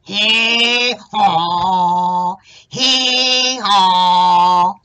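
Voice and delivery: loud hee-haw donkey bray. Acted. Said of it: "kinda went like this".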